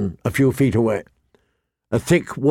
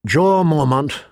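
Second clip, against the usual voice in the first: plain reading voice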